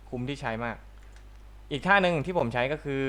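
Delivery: normal talking voice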